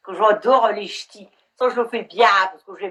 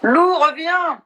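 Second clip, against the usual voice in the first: calling out; 7 semitones higher, 4 dB louder, much slower than usual. Real, not acted. Calling a disconnected guest.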